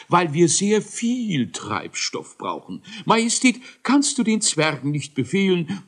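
high, fussy inventor voice begging the king; a worried little scientist asking for help; complaining professor voice; pleading scientist. Acted.

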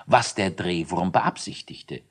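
usual speaking voice

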